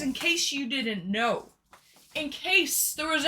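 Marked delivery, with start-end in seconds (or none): none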